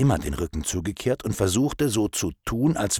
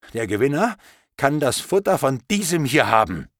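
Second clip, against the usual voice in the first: angry; 8 semitones higher than usual. Acted. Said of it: "Mittlerweile war ich total wütend".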